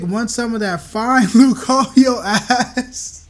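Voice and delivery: reading through giggles; slow, laughing while reading. Real, not acted.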